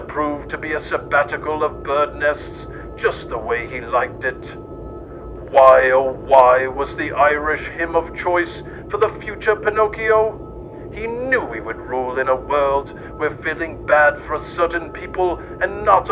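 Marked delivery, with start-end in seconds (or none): none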